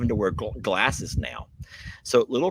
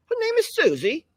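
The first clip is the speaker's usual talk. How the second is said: high, mock-posh British voice; trying on a British accent for the goat; 4 dB louder than usual. Host tries a British accent for Susie. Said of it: "Do I sound anything like British?".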